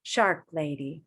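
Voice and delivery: ordinary talk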